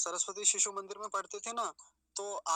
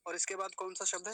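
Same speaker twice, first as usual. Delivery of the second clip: ordinary talk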